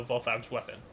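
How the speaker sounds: normal talking voice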